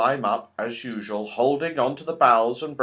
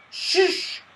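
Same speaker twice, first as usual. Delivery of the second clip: unvoiced urgent hiss of a shush; alarmed shush. A narrator's reading. Squirrel warning the children of goblins, alarmed hush. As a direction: hush them in a fright. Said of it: "said the squirrel in alarm".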